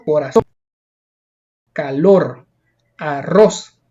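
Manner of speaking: corazón, calor, arroz as spaced examples, each stressed at the end; last-syllable stress. Shown as a demonstration. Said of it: "la mayor fuerza de voz en la última sílaba".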